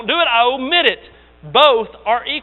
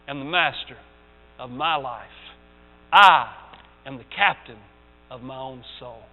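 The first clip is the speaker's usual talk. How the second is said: poem recital; 3 semitones lower than usual. Acted. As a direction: fade the proud last lines down low. Ends the poem reading.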